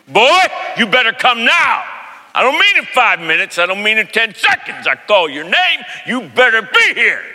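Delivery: imitating a stern, shouting dad; dad's commanding voice. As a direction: thunder it like a dad summoning his boy. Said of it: "had a voice that could shake you".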